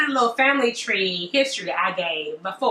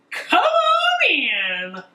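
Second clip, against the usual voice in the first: very slow, higher drawn-out welcome; slow welcoming Laban; 7 semitones higher, much slower than usual. Acted. Laban welcoming the servant in, very slow and high. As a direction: stretch it out like a big host greeting.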